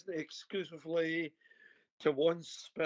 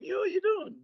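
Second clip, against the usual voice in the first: surprised; 4 dB louder than usual. Real, not acted. Surprised nobody drinks coffee.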